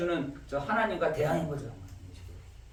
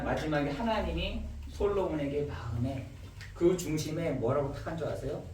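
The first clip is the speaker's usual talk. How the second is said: usual speaking voice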